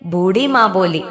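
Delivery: ordinary delivery, nothing marked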